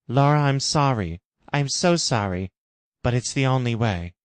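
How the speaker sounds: apologetic Jim. Acted. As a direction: break the bad news gently.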